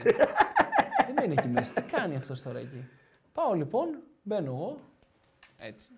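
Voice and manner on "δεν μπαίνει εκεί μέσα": laughing through the words, higher and slow. Real, not acted. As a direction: chuckle it out, amused at the memory. Laughing at the coach waiting.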